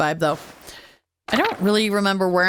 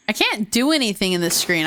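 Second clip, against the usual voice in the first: ordinary talk, nothing marked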